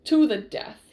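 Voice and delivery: loud, forceful declaration; battle challenge. A narrator's reading. Prince advancing with his sword, loud challenge. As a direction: throw it out loudly as a challenge.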